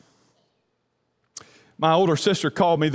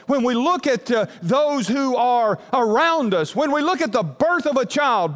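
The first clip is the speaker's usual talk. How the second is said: 1 building preaching; 3 semitones higher than usual. Acted. Pastor builds preaching passage.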